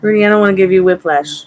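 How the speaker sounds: loud, half-laughing call to the dog. Real, not acted. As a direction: call out to the dog across the room. Scolding dog while calling.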